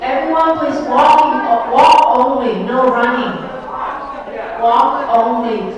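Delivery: loud, firm call to walk; sharp playground warning. Real, not acted. Calling out to arriving crowd.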